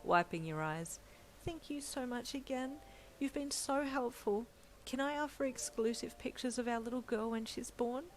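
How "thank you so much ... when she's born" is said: lightly voiced wife, a touch higher; grateful young wife. Wife thanking the editor, lightly higher. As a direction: offer it warmly and shyly. Wife's voice in the story.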